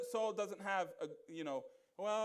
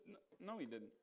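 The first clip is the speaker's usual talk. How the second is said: softer, dropped-low dismissal; dry rebuttal; 7 semitones lower, 12 dB quieter, faster than usual. Real, not acted. Preacher quietly dismissing a member's claim, dry. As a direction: shut it down quietly, flatly. Flat rebuttal after mock excuse.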